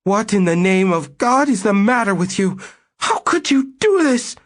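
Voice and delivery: a wife shrieking at her cheating husband; shrill outrage. Acted. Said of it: "in a shrill voice".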